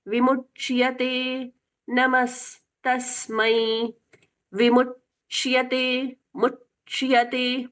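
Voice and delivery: slow chant, विमुच्यते with the य clear after च; clear chya sound. Shown as a demonstration. Teacher drilling the च-य join, slow chant. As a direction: draw out मुच्यते, letting the य ring after च. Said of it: "च के साथ में य स्पष्ट सुनाई देता है".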